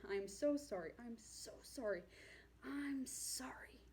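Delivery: acted apology fading to a whisper; mortified apology. Cabin manager's mortified apology, acted.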